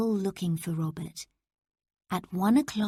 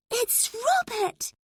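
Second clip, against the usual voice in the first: high, slow excited cry of recognition; child recognizing her friend's scream, excited; exclaiming; slower than usual. A narrator's reading. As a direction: call out his name, thrilled. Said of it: "Lucy exclaimed".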